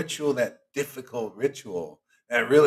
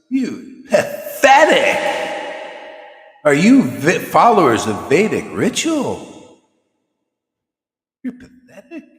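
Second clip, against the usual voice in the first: high, loud, slow scornful shout; guru's scolding; 5 semitones higher, 10 dB louder, slower than usual. Retold or imitated. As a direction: thunder it down at them like a stern guru. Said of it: "this is how he's saying".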